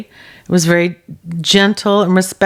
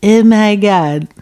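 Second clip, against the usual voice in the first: stunned realization; 2 semitones higher, slower than usual. Retold or imitated. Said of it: "And I was like".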